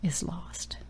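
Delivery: breathed softly; hushed. Real, not acted. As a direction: drop to a near whisper after long pauses. Dramatic pause, hushed close.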